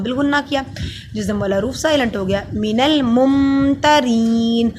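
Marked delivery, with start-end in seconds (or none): 2.5-4.7 slow, louder recited word; recited demo word. Shown as a demonstration. Teacher models the word for students in slow chant. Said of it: "مم پڑھا".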